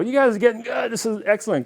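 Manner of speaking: high-pitched retold cheer; encouraging praise. Runners praising high school kids playing, high-pitched encouragement. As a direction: call it out like an impressed passing runner.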